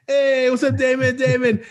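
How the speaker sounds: high, cheery mimicked crowd hello; crowd greeting Damon. Students greeting late kid Damon, high cheery mock welcome. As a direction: call out his name like the whole room greets him. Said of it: "everybody starts saying".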